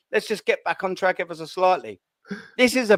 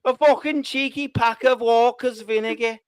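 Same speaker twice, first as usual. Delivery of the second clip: mock Scouse accent; 3 semitones higher than usual. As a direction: put on a hammed-up Liverpool accent. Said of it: "There's the Scouse accent".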